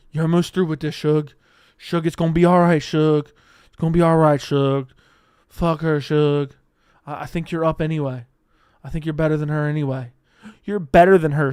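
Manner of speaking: loud hammed-up encouragement, rising to shouts; rally a downed fighter like his cornerman, tongue in cheek; mock-earnest cheering; mock pep talk.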